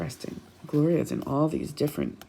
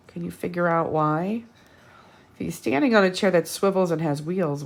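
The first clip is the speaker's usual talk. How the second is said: ordinary delivery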